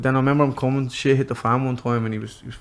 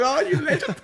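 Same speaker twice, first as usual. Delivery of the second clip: much higher, gleeful praise then a laugh; delighted laugh; 14 semitones higher than usual. Retold or imitated. Praising his daughter for finding coins, high and laughing. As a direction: squeal it out, tickled pink.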